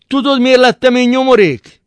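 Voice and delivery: furious shouted question; shouting. A narrator's reading. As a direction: yell the question in his face. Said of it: "Kiáltott Szűcs Imre".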